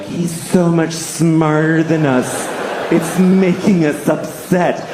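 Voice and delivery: slow, whining mock nerd voice; nerdy geniuses. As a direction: imitate upset nerds, dragging the words. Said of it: "all the nerdy geniuses".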